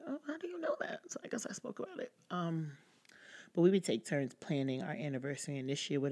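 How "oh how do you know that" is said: very high-pitched mock surprise; retold surprise. Retelling her reply to somebody, high surprised voice. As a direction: act out being caught off guard, high. Retells own reaction to being told.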